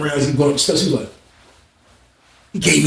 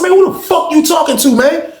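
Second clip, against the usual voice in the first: much higher, raised-voice challenge; indignant confrontation; 12 semitones higher than usual. Retold or imitated. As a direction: act out an incredulous, heated comeback. Acting out angry confrontation over money.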